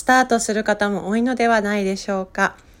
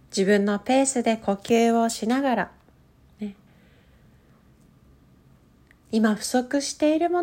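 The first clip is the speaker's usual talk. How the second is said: slow, calm meditation guide voice; guided meditation; 3 semitones higher than usual. Acted. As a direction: lead them gently into stillness. Leads a guided breathing meditation.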